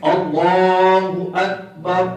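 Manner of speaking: slow, smooth اللہ اکبر without a jolt; correct takbir. Shown as a demonstration. Teacher models the right takbir for students, slow and even. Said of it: "درست تلفظ کیا ہے؟".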